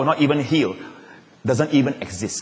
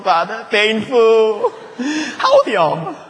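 higher, slow mock crying, half laughing; mock whining child; 7 semitones higher, much slower than usual. Child made to eat healthy food, mock crying to father. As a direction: whimper it like a child being fed, then break into laughter.

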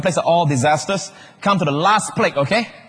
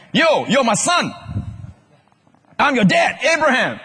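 Abraham greeting his son; 8 semitones higher than usual. Acted. Preacher playing Abraham meeting Joseph, hammed-up greeting. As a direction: call out a grand, playful introduction. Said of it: "Then here came Abraham".

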